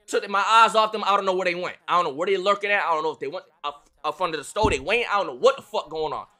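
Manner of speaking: ordinary delivery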